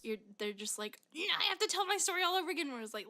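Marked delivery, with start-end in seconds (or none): 1.1-2.7 high, fast whiny complaint; mock mad customer. Customer made to repeat their story, mock mad. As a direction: rattle it off high, imitating an annoyed customer. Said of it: "they get so mad".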